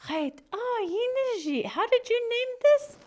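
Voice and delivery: very high, amazed question; wondering bluebird. Acted. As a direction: ask in high-pitched wonder. Bluebird admires the hogan.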